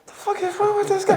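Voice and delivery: very high-pitched imitated disbelief; mock bafflement. Drivers watching a man in a wheelchair, imitated baffled disbelief. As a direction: play a passing driver gawking in confusion.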